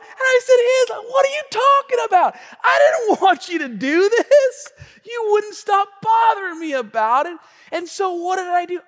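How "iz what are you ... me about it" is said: high, loud indignant protest; exasperated dad. Retold or imitated. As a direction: throw the blame back, hammed up. Dad's exasperated retort to daughter.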